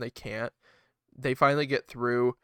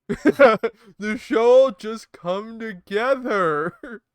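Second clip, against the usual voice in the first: very slow, dreamy; mock bookers; 5 semitones higher, 4 dB louder, much slower than usual. Voicing clueless bookers, slow mock optimism. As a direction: drawl it with empty confidence.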